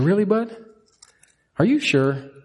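Really jumps high, curious; mock surprise. Father gently questioning his scared son, surprised. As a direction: play surprised to coax him.

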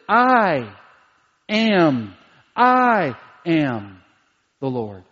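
I set apart with pauses, am the Lord falling away; stress on I. Shown as a demonstration. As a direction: hit I, pause, then let the Lord drop. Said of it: "that I is emphatic".

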